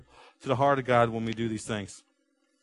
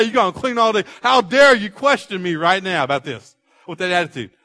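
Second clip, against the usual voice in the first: loud, higher-pitched parental rant, building; indignant parent; 5 semitones higher, 7 dB louder than usual. Acted. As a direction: pile up the chores, louder and higher as you go. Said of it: "And as a parent, like".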